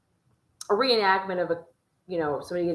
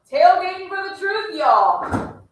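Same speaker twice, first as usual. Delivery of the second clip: higher, louder hype call; hype shout; 11 semitones higher, 8 dB louder, slower than usual. Real, not acted. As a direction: whip up the crowd.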